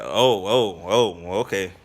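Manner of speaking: a lightly voiced flustered chief; taken-aback chief. Chief caught off guard by the joking recruit, lightly flustered.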